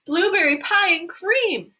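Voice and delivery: high, slow delighted shout. Acted. A girl shouting her favourite flavour to the room, high and slow. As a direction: crow it out like a greedy girl. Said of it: "shouted Violet".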